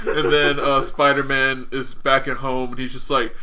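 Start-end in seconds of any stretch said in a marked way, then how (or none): none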